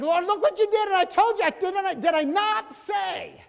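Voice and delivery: very high, loud, slowing indignant shout; mock outraged shout. A believer yelling at God, look what you did, shouted high. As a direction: yell at God in comic outrage. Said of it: "It's like".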